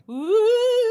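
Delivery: high, drawn-out ghostly ooh; a long, high mock-ghost wail; spooky ghost ooh. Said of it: "be gold and be like".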